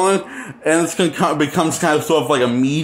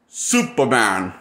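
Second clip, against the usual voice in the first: bad acting; 5 semitones lower, slower than usual. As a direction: lower and slow, dramatic reveal. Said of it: "He delivers it like that".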